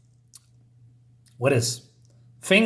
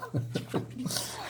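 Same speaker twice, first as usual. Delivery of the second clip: breathy laugh; 8 semitones higher, 14 dB quieter than usual. Real, not acted. Laughs at the comic joke.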